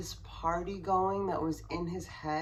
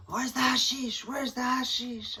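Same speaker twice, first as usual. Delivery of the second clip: high, loud retold scream, hammed-up; imitated screaming; 7 semitones higher, 5 dB louder than usual. Husband screaming at the police about the hashish. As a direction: shout it like a drunk man losing control. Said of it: "And he's screaming".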